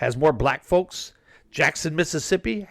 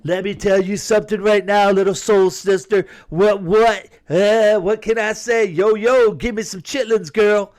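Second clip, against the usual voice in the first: higher, louder, over-the-top put-on Trump voice; Trump impression; 5 semitones higher, 6 dB louder than usual. Imagining Trump pandering in Detroit, loud hammed-up high impression. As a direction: ham up Trump pandering loudly to a crowd. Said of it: "could you see Trump?".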